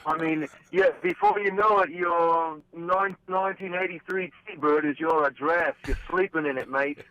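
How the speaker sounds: a lightly put-on English accent, played for laughs. Joking with the host about road costs, in a light mock English accent. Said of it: "if we're gonna do an English accent".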